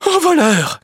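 high, loud outraged shriek; wife shrieking that he is a thief; shrieking cry. Acted. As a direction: shriek it in alarm. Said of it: "crie la femme".

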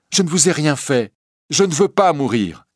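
high, loud desperate plea; frightened pleading. Acted. Bohémienne pleading with the recluse, frightened. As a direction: beg for your life. Girl begs for her life.

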